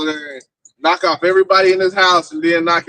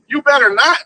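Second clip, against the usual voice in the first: mock stern father, higher pitch; scolding like a father; 9 semitones higher, 4 dB louder than usual. Mocking Kamala scolding nations like a father. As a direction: wag a finger like a dad. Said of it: "Talking to him like your father".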